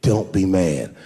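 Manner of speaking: low, loud, careful confession voice; sheepish son. Retold or imitated. Son phoning dad at night, low and careful. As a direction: imitate a son bracing dad for trouble.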